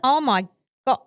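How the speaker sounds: reliving the shock of seeing the proposal; stunned oh my God. Retold or imitated. To the host, reliving seeing the proposal, loud stunned disbelief. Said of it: "that's all I kept saying".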